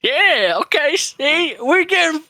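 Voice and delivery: high, loud and chirpy; gleeful. Acted. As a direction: gloat like a showman. Character gloats cheerfully.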